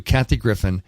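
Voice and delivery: ordinary talk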